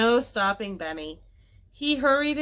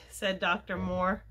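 ordinary delivery, nothing marked

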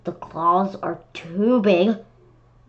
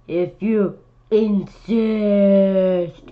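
rhino voice; 4 dB louder, much slower than usual. Acted. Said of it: "said the rhinoceros".

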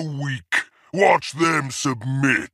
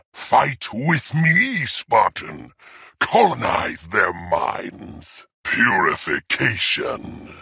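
ordinary delivery

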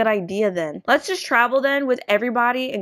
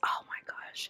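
breathless shocked whisper; 17 dB quieter than usual. Real, not acted. Shocked aside.

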